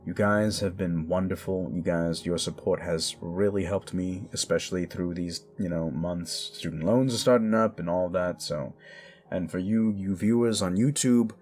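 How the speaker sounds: ordinary delivery, nothing marked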